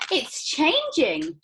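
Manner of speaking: high, loud and slow wonder; excited shout. Acted. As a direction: stretch it out in delight. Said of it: "shouted Violet".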